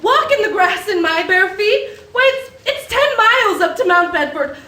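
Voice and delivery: shocked disbelief. Acted. Violet shocked by George's wild plan.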